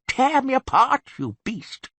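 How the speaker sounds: high mock girlish shout. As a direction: squeal it like a giddy girl. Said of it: "pull me by the ears and shout".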